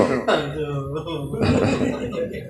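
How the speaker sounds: breathy trailing laugh; soft laugh. Real, not acted. Preacher to the congregation, laughing softly. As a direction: let the laugh fade out.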